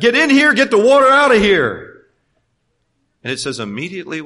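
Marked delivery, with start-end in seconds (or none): 0.0-2.0 louder, higher urgent call; eager shout. Retold or imitated. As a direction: urge him aboard with delight. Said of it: "I could just imagine that".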